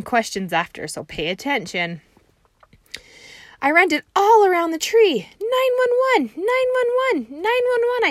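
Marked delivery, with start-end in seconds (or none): 3.6-5.3 put-on high, loud little-girl voice; Junie B. voice. Teacher reading Junie B. to first graders, in a high excited kid voice. 5.4-8.1 very high, loud panicked yell; hollering 911. Acted. Junie B. yelling for help at recess, high panicked holler. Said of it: "I hollered".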